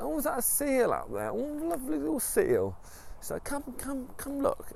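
very high, sing-song delight; wife's voice. Retold or imitated. Wife lures husband to cliff edge, high sweet voice.

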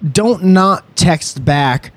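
slow, word by word reading; slow deliberate reading. Real, not acted. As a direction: spell out the rule slowly. Reading her written rule.

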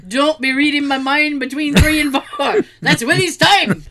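loud, comic Scottish brogue; hammed-up Scottish cartoon groundskeeper; Groundskeeper Willie. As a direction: holler it in mock Scottish, amused. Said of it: "I fucking love that Simpsons episode".

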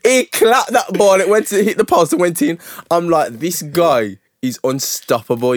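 high, loud awestruck retelling; excited fan raving about a striker; hyped disbelief. Retold or imitated. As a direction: relive the goal in amazed high pitch. Excitedly reliving a goal.

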